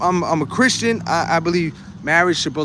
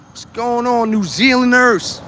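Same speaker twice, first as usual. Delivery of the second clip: excited greeting; 8 semitones higher, 4 dB louder than usual. Real, not acted. Greeting viewers from New Zealand.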